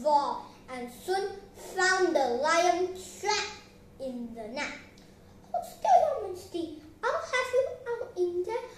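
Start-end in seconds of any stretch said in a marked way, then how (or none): 5.5-8.7 high, urgent little mouse voice; eager high-pitched mouse; squeaky mouse. Acted. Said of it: "squeaked the little mouse".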